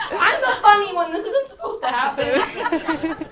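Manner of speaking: real embarrassed laughter during a toast; laughing. Real, not acted. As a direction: laugh off getting choked up. Brother breaks down mid speech.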